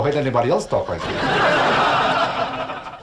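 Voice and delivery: slow, put-on seductive drawl; sexy continental voice. Shown as a demonstration. Comic shows off his mystery accent, slow seductive drawl. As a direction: stretch every word like a crooner. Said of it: "Not too many people talk like this".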